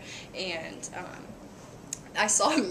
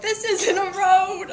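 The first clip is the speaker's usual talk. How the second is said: loud high yell at a driver; 12 semitones higher, 10 dB louder, slower than usual. Retold or imitated. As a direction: shout it out the window. People yelling at driver.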